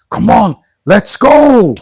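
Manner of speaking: drawn-out rallying shout; slow hype shout. Acted. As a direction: pump up the kids, slowly and loud. Hyping kids to start.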